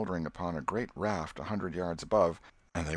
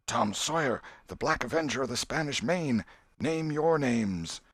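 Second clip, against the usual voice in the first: put-on grand, higher boy's voice, slowing at the end; pompous pirate boast; 6 semitones higher than usual. A narrator's reading. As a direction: proclaim the title like a storybook pirate. Tom announces pirate title.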